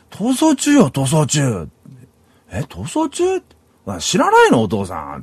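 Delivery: lightly acted daughter's voice; imitating incredulous daughter. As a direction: mimic a child amazed that dad doesn't know. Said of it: "娘がね".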